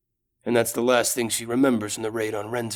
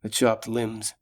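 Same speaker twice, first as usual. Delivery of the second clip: ordinary delivery, nothing marked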